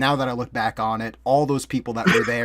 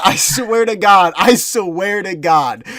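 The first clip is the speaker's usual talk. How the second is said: insistent; 6 semitones higher, 8 dB louder than usual. Real, not acted. Scared retelling, swearing it's true.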